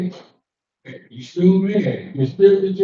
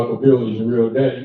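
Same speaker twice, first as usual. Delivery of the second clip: father's voice; 9 semitones lower, faster than usual. Retold or imitated. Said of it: "what my father used to say".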